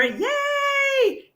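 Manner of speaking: high, shouted cheer; joyful yay. Real, not acted. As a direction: cheer her on. Celebrating listener quitting job.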